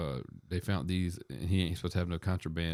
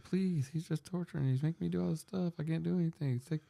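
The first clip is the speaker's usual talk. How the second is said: high, pleading voice; imitating a desperate prisoner begging the lawyer; 11 semitones higher than usual. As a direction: plead in a much higher voice, as if trapped. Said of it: "He's, like, begging".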